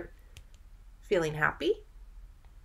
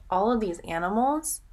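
ordinary delivery

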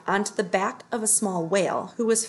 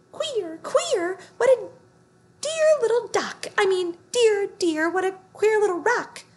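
very high, squeaky mouse voice; funny little voice; 12 semitones higher, 4 dB louder than usual. A narrator's reading. Mouse greets a rock in a squeaky voice. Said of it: "a funny little voice saying".

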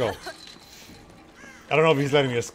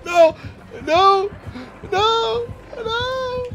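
each no higher than the last, trailing off at the top; rising panic; much slower than usual. Real, not acted. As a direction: climb in pitch as panic builds. Killer closing in.

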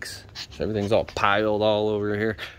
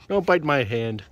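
normal talking voice

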